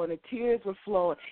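ordinary talk

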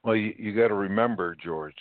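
ordinary delivery

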